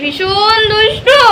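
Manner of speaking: ভীষণ দুষ্টু stretched slow and high, ending on a rise; mother cooing ভীষণ দুষ্টু slowly in a high baby voice; baby talk. Acted. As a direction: tease the baby, drawing out দুষ্টু. Mother teasing her baby.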